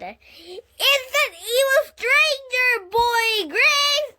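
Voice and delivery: high, loud dramatic shout; shouting back. A narrator's reading. Junie B shouting back to Grace about the stranger, high and loud. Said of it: "I shouted back".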